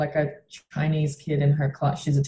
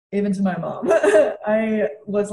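usual speaking voice

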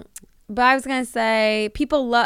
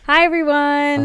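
loud host welcome; 5 semitones higher, 7 dB louder, slower than usual. Shown as a demonstration. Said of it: "let me do it".

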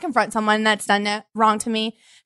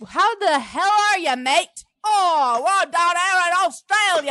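higher mock Aussie accent; Australian accent; 9 semitones higher, slower than usual. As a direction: put on a hammed-up Australian accent.

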